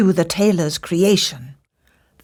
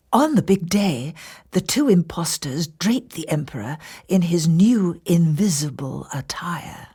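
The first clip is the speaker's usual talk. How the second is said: ordinary delivery, nothing marked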